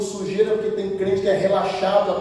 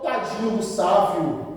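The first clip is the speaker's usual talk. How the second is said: soft, slow sympathy; mock pity; 2 semitones lower, 6 dB quieter than usual. Imagined pastor pitying the preacher, softly and slowly. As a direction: imitate someone feeling sorry. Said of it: "Nunca o pastor ou alguém chegou".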